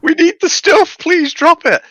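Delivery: high-pitched mock plea; pleading. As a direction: beg the pilot in a high, desperate retold voice. Acted pleading with pilot for supplies.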